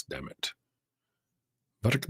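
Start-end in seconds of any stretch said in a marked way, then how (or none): 0.0-0.5 much lower, softer grumble; muttered curse. Real, not acted. Fighting his lights app, he mutters a soft low curse. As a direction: curse the lights under your breath.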